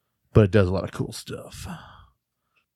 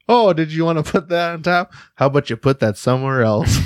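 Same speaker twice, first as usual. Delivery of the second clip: mocking voice; 7 semitones higher, 3 dB louder than usual. Mocking opponent while milling card.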